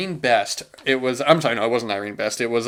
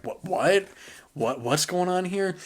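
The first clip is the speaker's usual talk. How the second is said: higher, bewildered what-what; pitched-up puzzled questioning; confused mock disbelief; 8 semitones higher, 4 dB quieter than usual. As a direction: sound lost at a sudden cut. Confused reaction to quick cut.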